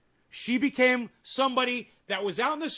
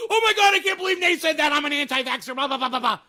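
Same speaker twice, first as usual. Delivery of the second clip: high, loud whiny mockery of an angry anti-vaxxer; mock outraged viewer; 5 semitones higher, 4 dB louder, faster than usual. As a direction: shriek it like a triggered commenter. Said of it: "They were so mad".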